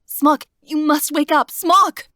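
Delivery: high, loud pleading shout; desperate plea. Acted. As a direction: beg him to wake up. Girl begs wounded Smock wake.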